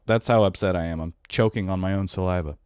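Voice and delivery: usual speaking voice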